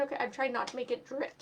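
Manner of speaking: plain reading voice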